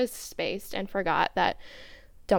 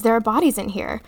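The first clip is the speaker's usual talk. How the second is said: louder, a touch higher narrated outburst; higher-pitched voice; 3 semitones higher, 9 dB louder, faster than usual. A narrator's reading. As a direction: read it loud, lifting the pitch slightly. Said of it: "his voice sounded much higher than usual".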